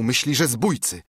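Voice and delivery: ordinary delivery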